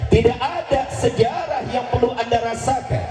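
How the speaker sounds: high, fervent sermon voice; full-force preacher declaiming; fiery preaching. Acted. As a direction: preach it out with rising fervor.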